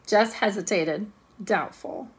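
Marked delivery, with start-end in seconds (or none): none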